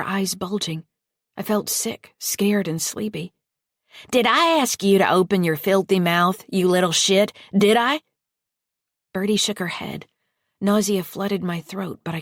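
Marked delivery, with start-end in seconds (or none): 4.1-8.0 put-on loud, rising snarl at the girl; furious yell. Foster mother screaming at the girl who talked back, furious. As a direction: tear into her, louder each time.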